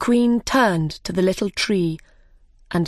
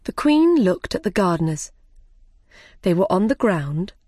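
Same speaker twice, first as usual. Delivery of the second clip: ordinary delivery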